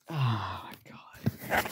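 slow, breathy whispered despair. Real, not acted. Gamer watching his team concede, whispering in despair. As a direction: let it collapse into a whisper.

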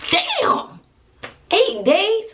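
high-pitched disbelieving "damn"; high outraged surprise; incredulous. Retold or imitated. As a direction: squeak it out in disbelief. Disbelief at eight days.

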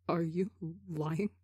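soft, slow, fearful question; terrified stammer. A narrator's reading. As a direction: ask haltingly, afraid of the answer. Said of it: "Ranbu stammers, sounding absolutely terrified".

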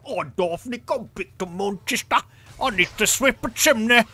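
loud, high, mangled mock-English sitcom accent; bad Manchester Cockney. Mimicking Daphne's ex-boyfriend, hammed-up Manchester Cockney accent. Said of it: "it's the guy who's her ex-boyfriend".

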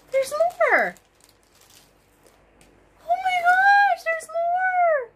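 very high-pitched squeal of delight; high, thrilled outburst at a surprise find; astonished squeal. Real, not acted. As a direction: squeal in happy disbelief. Discovers still more yarn in package.

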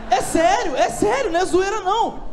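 high, emphatic insisting. Real, not acted. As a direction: swear it's true.

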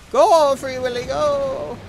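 cheering on. Real, not acted. Cheering on special move.

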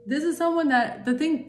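a sudden flare of real anger; angry. Real, not acted. Reader venting to viewers about a betrayal, angry. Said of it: "what's really pissing me off".

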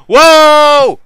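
sudden loud high whoa of fright; real startled shout; startled yell. Real, not acted. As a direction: jump out of your seat yelling. Startled again by game monster.

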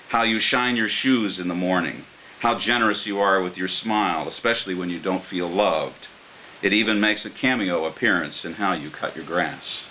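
normal talking voice

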